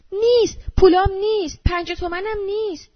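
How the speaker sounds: high, loud outcry over missing money; panicked cry. A narrator's reading. Woman on the bus finds her money gone, panicked cry. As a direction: cry out high, easing in volume on the last phrase. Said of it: "زن فریاد کشید".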